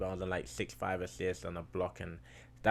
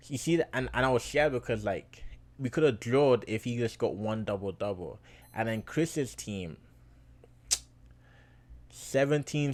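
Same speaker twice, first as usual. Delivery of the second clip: hyped rant; 3 semitones higher, 6 dB louder than usual. Real, not acted. Vlogger to his viewers, reliving the close game, loud and fired up. Recounting scared close win.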